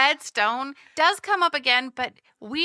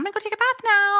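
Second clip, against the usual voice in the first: suddenly higher and quicker, a breezy switch; hammed-up mom breezing off to her bath; offhand mom quote; 9 semitones higher than usual. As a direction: flip to a careless higher tone after the insults. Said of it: "But the mom's just like".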